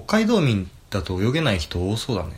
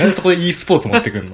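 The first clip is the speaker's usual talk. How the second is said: higher, louder, fast protest; exasperated teasing; 7 semitones higher, 6 dB louder, faster than usual. Real, not acted. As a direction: call out the silly answer. Teasing protest about esports.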